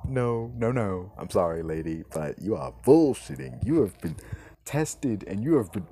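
ordinary talk

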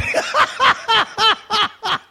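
long, high-pitched laugh; real laughter. Real, not acted. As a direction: laugh out after rejoicing. Bursts out laughing after amen.

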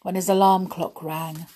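ordinary delivery, nothing marked

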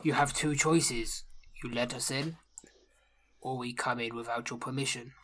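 low, quiet, measured threat; orc chieftain. Acted. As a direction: lay out an ultimatum calmly and softly. Orc gives an ultimatum.

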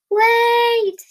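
high, loud drawn-out call; storybook cry to hold on; yelled. Acted. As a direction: yell after someone getting away. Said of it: "yelled Dorothy".

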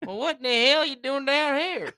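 put-on Southern accent. Texan confronting visiting New Yorkers, hammed-up Southern accent. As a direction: ham up a Southern challenge. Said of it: "they got that southern accent".

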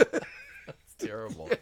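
squeaky slow whisper; high drawn-out breathy whisper. Pretend. Mock horror aside.

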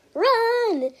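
urgent shout. A narrator's reading. Harry warns the others as warriors spot them, shouting. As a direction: snap the warning out.

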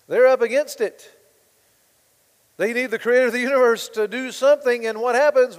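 preacher raising the storm for the congregation, loud and urgent; building preacher's cry. Acted. Preaching at full force.

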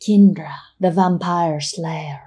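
slow, understated dramatic self-introduction; cool. Acted. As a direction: announce the title coolly, word by word. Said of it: "The assassin responds coolly".

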